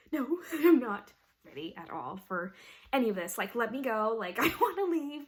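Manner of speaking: loud retold panic, half laughing; panicked refusal. Nursing student balking at the needle, panicked refusal. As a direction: plead loudly to get away, mock-dramatic.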